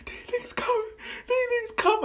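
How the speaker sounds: high, breathless whimper; terrified kid crying on his bike; crying whisper. Retold or imitated. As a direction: sob out the warning to Corey. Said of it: "I'm crying and shit".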